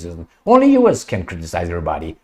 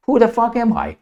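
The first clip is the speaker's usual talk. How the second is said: much higher incredulous cry; indignant outburst; 11 semitones higher than usual. Real, not acted. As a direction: voice shooting up in disbelief.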